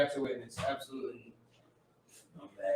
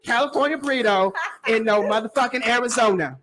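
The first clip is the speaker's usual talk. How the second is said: mock outrage; 6 semitones higher than usual. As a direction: play the offended local, laughing. Voices angry locals.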